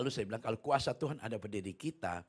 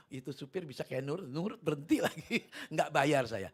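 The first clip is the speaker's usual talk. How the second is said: usual speaking voice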